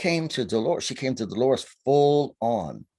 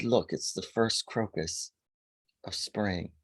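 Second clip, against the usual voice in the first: softer, slow and hushed; soft wonder; 2 semitones lower, 6 dB quieter than usual. Retold or imitated. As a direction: show it with quiet awe. Fairy shows the first crocus.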